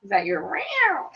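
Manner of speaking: ordinary talk, nothing marked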